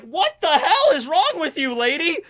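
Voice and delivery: high, loud acted disbelief; indignant outburst. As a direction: demand an answer from your crazy attacker. Noelle reacts to being attacked.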